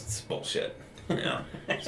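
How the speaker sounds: a whispered, grumbling aside; doctor grumbling quietly to patient; muttered gripe. Retold or imitated. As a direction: let it slip under your breath. Doctor griping about being understaffed.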